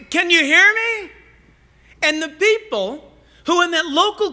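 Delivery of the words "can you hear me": higher, louder call to a crowd. Retold or imitated. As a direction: project it to the back of the room. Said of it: "they would say".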